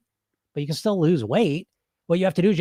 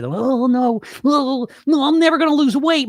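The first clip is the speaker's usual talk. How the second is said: high, whimpering despair; whiny inner voice; 9 semitones higher, 5 dB louder than usual. Retold or imitated. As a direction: play the defeated little voice in your head.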